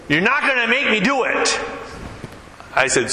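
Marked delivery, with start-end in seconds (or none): 0.1-1.6 high-pitched, mildly defiant retort; mock defiance. Big man resisting the preacher's Jesus talk, mock defiance. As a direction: imitate a man refusing to be pushed.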